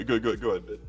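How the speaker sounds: usual speaking voice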